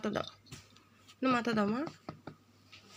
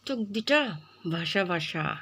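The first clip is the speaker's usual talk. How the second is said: ordinary delivery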